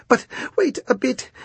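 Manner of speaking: high, tiny pleading oyster voices; little oysters begging for a pause; squeaky oysters. A narrator's reading.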